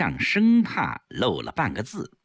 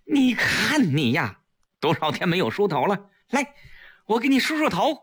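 higher, showy fussing; speaking up; 6 semitones higher than usual. Acted. Woman offers to comb prisoner's hair, speaking up showily. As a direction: fuss over her for outside ears. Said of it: "大声说".

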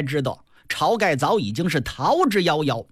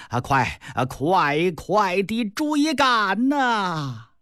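slow, stretched-out call to chase; drawn-out shout; much slower than usual. Acted. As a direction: drag every word out lazily. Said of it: "但是他拉长音儿".

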